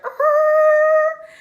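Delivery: high loud mock yell, no words. Cousin in the back yells out, loud mock yell. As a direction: belt out a wild cry.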